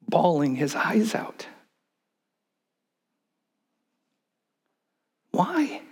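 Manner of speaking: hushed, breathy whisper, the high Why almost a gasp; dramatic whisper. Real, not acted. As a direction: drop to a whisper to show his grief. Preacher describes Jesus weeping.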